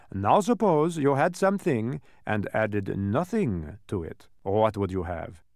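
slow, deliberate character voice; dodecahedron, quizzing. Acted. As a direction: pose the puzzle slowly, like a teacher.

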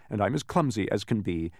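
ordinary delivery, nothing marked

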